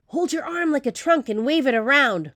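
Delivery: ordinary delivery, nothing marked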